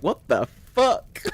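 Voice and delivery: slow, high incredulous reaction; retold disbelief. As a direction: draw it out, utterly baffled. Acted stunned reaction to ex's claims.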